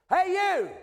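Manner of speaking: a loud, high shout across a crowd; calling out. Retold or imitated. Stranger shouting after the preacher in the terminal, loud call. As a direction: yell to catch someone walking away.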